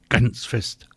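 ordinary delivery, nothing marked

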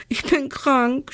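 high, slow mock sob; schluchzend. A narrator's reading. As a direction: wail it in an acted tearful voice. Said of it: "schluchzt sie". (gespielt weinerlich, hoch und langgezogen)

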